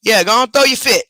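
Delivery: high, loud dare at the dog; loud taunt. Real, not acted. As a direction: egg on his tantrum loudly. Taunting the dog's tantrum.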